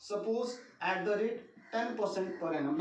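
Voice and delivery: slow dictation pace; speaking while writing. Real, not acted. Teacher saying aloud what he writes, slow dictation. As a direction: say it slowly as you write it out. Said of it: "मैं बोल भी रहा हूँ साथ के साथ".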